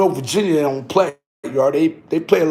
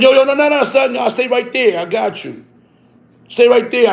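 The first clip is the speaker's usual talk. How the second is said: higher, louder alarmed "no, no, no"; alarmed refusal; 8 semitones higher, 4 dB louder than usual. Retold or imitated. The man at the door, alarmed, blocking him from rushing in. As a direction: stop him at the door in a hurry.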